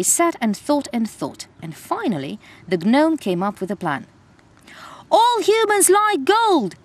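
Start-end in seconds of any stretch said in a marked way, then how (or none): none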